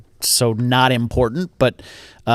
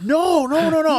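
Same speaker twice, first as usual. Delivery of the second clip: son's denial; 5 dB louder than usual. Retold or imitated. Son denies calling dad names.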